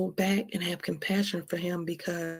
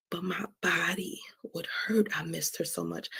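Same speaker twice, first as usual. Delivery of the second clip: softer, near-whispered ache; pained hush; 3 semitones lower, 8 dB quieter than usual. Real, not acted. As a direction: let the missing hurt pull the voice down. Aching memory of missing daughter.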